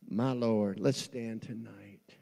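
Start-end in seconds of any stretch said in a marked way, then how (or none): none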